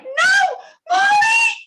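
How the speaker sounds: high, loud crying shriek. Acted. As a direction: shriek as the werewolf lunges. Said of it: "as Amy shrieks".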